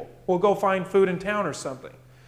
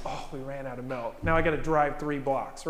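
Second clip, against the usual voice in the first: much softer, lower, mock-dramatic moan; mock crisis; 5 semitones lower, 6 dB quieter than usual. Preacher mocking himself over milk, lower and softer mock whine. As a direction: play up a tiny problem as a disaster. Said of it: "Sometimes I act like it's a crisis".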